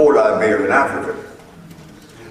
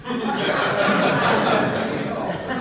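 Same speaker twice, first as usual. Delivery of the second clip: airy laugh; 8 semitones higher, 4 dB quieter than usual. Real, not acted. Laughs after the judge's line.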